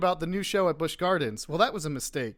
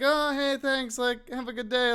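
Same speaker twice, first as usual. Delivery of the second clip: higher, awkward polite brush-off; 8 semitones higher than usual. Retold or imitated. Drew brushing off the fan on his date, higher awkward politeness. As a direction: chirp a quick thanks to get rid of him. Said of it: "And I was like".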